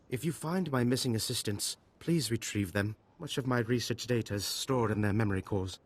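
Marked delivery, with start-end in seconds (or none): none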